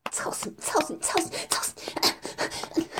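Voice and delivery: unvoiced, panting whispered chant; breathless whisper. Dominant woman whispering at her partner mid-act, breathless whisper.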